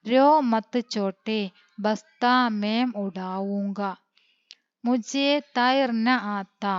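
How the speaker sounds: slow word-by-word reading of the dialogue; slow dictation. Shown as a demonstration. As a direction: read it back slowly for the class to copy. Recap of the Hindi dialogue. (പതുക്കെ, ഓരോ വാക്കും വ്യക്തമായി വായന)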